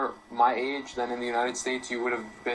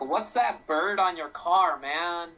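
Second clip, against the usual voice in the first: slow, higher puzzled American asking "What's that bird"; 5 semitones higher, 3 dB louder, much slower than usual. Retold or imitated. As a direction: play a baffled local at the car. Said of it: "it's really like".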